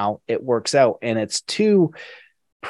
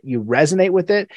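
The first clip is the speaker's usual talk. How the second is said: ordinary delivery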